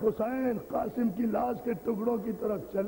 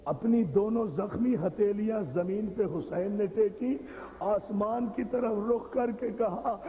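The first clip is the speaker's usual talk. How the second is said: ordinary talk, nothing marked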